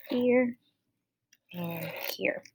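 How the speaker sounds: quietly, here and here; hushed working aside; whispered aside. Real, not acted. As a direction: drop to a whisper while marking. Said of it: "marks the consonant breaks".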